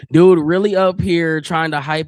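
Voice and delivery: loud, slow hype shout. Real, not acted.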